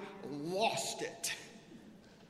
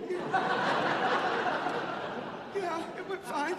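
choked, higher, laughing through tears; trying not to cry; 12 semitones higher, 4 dB louder, much slower than usual. Retold or imitated. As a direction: fake being fine while crying. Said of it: "are you crying?".

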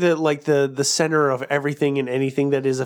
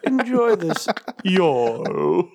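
lilting British send-up, pitched up; mock British accent; 4 semitones higher, much slower than usual. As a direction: play a Brit straining to say y'all. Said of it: "ask a British person to say".

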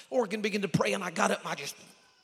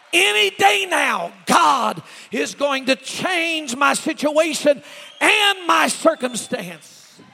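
high, loud, slow proclamation; full-force preaching; 6 semitones higher, 17 dB louder, much slower than usual. Acted. Preacher declaring God will change things, full-force preaching. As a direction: cry it out slowly, stretching the big words.

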